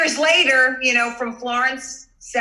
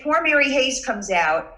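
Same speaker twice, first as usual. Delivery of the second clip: ordinary talk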